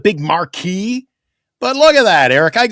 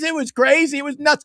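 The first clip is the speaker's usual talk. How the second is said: ordinary talk, nothing marked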